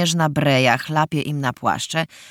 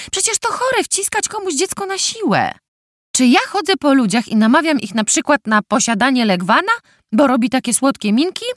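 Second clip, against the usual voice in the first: indignant rant; 9 semitones higher, 4 dB louder than usual. Acted. Dagna oburzona rozmową o dzieciach.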